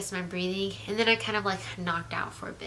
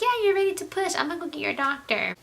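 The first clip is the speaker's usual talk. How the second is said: much higher singsong nurse voice; mock upbeat nurse; 10 semitones higher, 4 dB louder, faster than usual. Nurse telling the mother she is ready to push, high mock cheer. Nurse announces pushing again.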